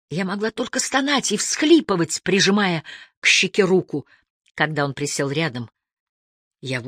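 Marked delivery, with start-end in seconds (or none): none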